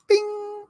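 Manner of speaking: very high held "bing"; imitated chime. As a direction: ring it out like a little bell. Imitates a chime before reading.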